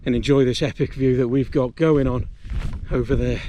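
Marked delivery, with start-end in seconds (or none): none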